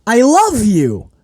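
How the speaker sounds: high, slow mock confession; mock Riddle. Mimicking Riddle to the hard cam, mock lovestruck. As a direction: swoon the line like a needy kid. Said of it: "he says".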